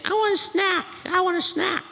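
put-on high, pleading child voice; childish whine for a snack; whiny little kid. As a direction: beg like a small kid. Little kid asking parent.

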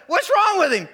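high, loud worried question; mock alarm. Husband asking wife why baby screams, mock alarm. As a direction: play up the clueless dad.

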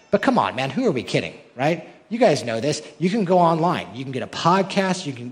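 ordinary talk, nothing marked

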